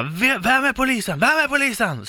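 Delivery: mocking driver voice. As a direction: parody his incredulous outburst. Chauffören härmas, hånfull fråga. (hånfull härmning av chauffören)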